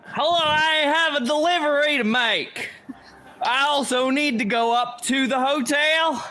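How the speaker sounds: high, stiffly announced disguise voice; fake delivery person. Sneaking character poses as courier, put-on announcing voice. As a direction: declaim it as an obvious impostor.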